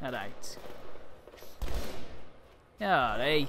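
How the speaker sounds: trying out a Mancunian greeting; put-on Manc accent; Manchester accent. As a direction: practise a Manchester hello. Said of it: "isn't in the doing the accents".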